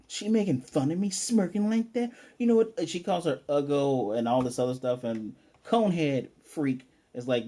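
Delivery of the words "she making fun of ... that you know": higher-pitched indignant protest; mildly hammed-up outrage; imitated pissed-off boy. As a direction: act out an offended kid. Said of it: "Damien, who's pissed".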